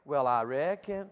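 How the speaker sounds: slow Southern drawl. Shown as a demonstration. Preacher coaching Yankees in the room to join in, Southern drawl. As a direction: lead them in an exaggerated Southern twang, slowly. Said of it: "You Yankees in the room, try it".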